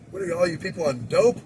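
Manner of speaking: a hint of an exasperated teacher; stern teacher. [name] quoting Mister Hand scolding the class, mock stern. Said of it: "so what Mister Hand would say".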